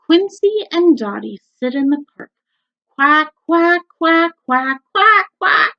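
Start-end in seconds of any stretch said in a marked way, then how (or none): 2.9-5.7 high, loud quacking that builds; loud duck shout. A narrator's reading. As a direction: shout the quacks, getting higher. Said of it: "shouts Dottie".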